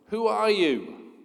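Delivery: a slow, high yell into the mountains; a boy shouting a slow question; curious yell. Acted. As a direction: call out to find who's there. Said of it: "he yells".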